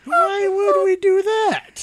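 high, slow indignant squeak; mock outrage. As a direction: protest in fake shock.

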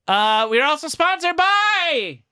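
high, slow hammed-up sponsor announcer. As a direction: sell it like a cheesy radio ad.

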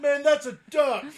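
laughing, loud yelled line; angry bus yeller. Retold or imitated. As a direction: yell it while cracking up. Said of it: "The duck racist".

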